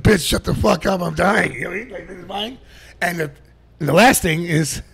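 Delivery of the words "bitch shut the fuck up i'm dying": loud mock outburst of a dying man; imagined angry father. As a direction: snap loudly as the fed-up father. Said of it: "He must be like".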